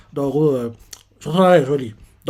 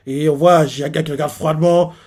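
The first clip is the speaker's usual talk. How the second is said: ordinary talk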